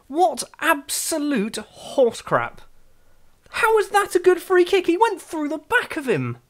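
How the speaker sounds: real disbelief, voice jumping up high; furious outburst. Real, not acted. Gamer raging at the referee's call, furious high outburst.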